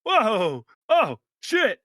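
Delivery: high, loud startled exclamation; surprised NPC. Acted. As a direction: react like spotting an old friend. Marble NPC surprised to see Riley.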